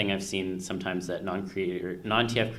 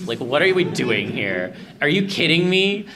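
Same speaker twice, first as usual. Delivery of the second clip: much higher and louder, slow disbelieving yell; exasperated yell; 7 semitones higher, 8 dB louder, slower than usual. Retold or imitated. Host yelling at a sulking dragon character, exasperated. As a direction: throw your hands up at him in mock outrage.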